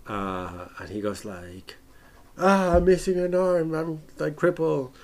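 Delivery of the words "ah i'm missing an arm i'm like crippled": over-the-top melodramatic lament; mock freak-out. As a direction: ham up a wounded hero's despair. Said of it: "like, he just freaks out".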